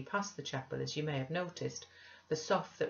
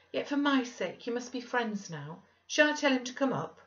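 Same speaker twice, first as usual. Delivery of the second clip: eager, high-pitched pleading; slightly repressed delight; 8 semitones higher, 4 dB louder than usual. A narrator's reading. Wife pleading for her friend with husband, eagerly delighted. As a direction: coax him, excitement rising into the question. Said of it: "repressing a little the intensity of her delight".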